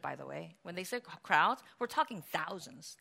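usual speaking voice

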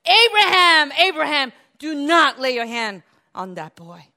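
loud, high urgent call; crying out from heaven. Retold or imitated. Voice from heaven calling Abraham to stop, loud and urgent.

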